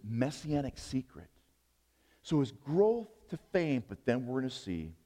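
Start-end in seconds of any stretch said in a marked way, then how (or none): none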